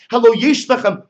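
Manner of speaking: loud high sarcastic call; mock her scolding them; mock rebuke.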